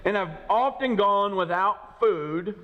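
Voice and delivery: slow, high, drawn-out reading; Paul's sufferings read slowly; slow scripture reading. Acted. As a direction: dwell on each hardship.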